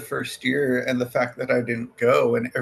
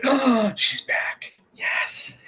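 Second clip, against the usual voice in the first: high, drawn-out retold excitement; thrilled reaction; 12 semitones higher, much slower than usual. Telling co-host about Lenore's return, thrilled reaction. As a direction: gasp it high and slow, fading into a hushed yes.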